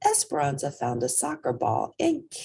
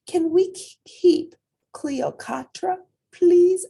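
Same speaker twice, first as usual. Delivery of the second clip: put-on pleading child; 8 dB louder than usual. Child begs mother for kitten.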